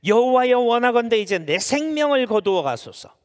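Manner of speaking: loud, high scriptural plea; declaimed prayer. Acted. Jonah begs God to take his life, declaimed prayer. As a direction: cry it out like an old-style prayer. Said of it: "요나의 기도를".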